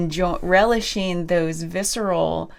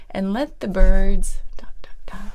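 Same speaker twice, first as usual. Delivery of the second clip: dreamy whisper. Retold or imitated. Quoting the client's dreamy words.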